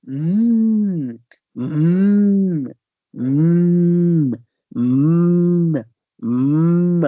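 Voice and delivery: long loud closed-lip mmm; yummy-food humming. Shown as a demonstration. Teacher showing the M sound as tasty food, savoring hum. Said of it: "O som de uma comida muito gostosa".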